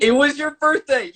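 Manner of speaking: high, loud, delighted exclamation; excited agreement. Real, not acted. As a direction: jump in excitedly on a shared memory. Delighted at guest recalling birthday.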